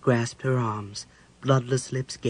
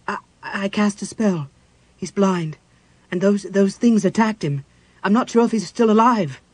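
high, halting, growing louder with worry; shaken stammer; 6 semitones higher, 6 dB louder than usual. A narrator's reading. Cleric tells mage his brother was attacked, stammering and worried. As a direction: stumble through the bad news anxiously.

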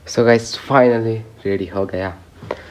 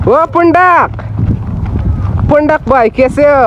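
loud, high call across the distance; 8 dB louder than usual. Real, not acted. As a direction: shout his name to get his attention. Calling out to a friend. (दूर से दोस्त को ज़ोर से आवाज़ लगाना)

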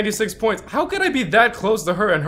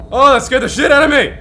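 normal talking voice

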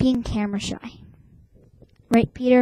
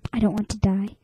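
ordinary talk, nothing marked

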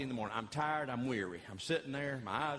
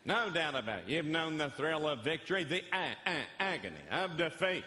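higher, slow sportscaster cadence; Howard Cosell; 4 semitones higher than usual. Retold or imitated. Pastor to Muhammad Ali, doing Howard Cosell. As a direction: do Cosell calling the fight. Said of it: "I went into Howard Cosell and went".

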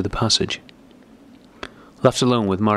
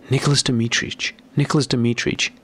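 usual speaking voice

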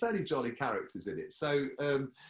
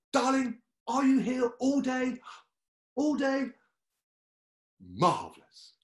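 posh Molly; 13 semitones higher, 6 dB louder than usual. Retold or imitated. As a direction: sing it out grandly. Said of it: "She'd say it to everyone".

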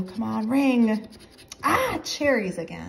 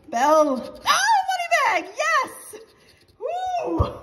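very high, loud whoop of joy; real excited celebration; thrilled win; 6 dB louder, slower than usual. Real, not acted. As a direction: shout it up high as you spot the winner. Finds winning money bag symbol.

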